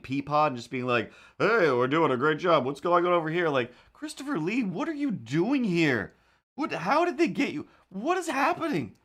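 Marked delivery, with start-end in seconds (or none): 1.4-3.5 higher, louder chipper scientist boss; a lightly voiced cheery head scientist. Christopher Lee voiced cheerily. 6.6-9.0 much higher, incredulous cries; shocked he's in this; high, baffled what-is-happening; stunned disbelief. Real, not acted. Shock at Lee's casting.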